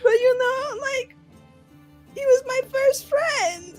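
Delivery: put-on high, tearful childish whimper; upset six-year-old. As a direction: whimper slowly like a heartbroken child. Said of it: "like, a six-year-old who's just, like, upset".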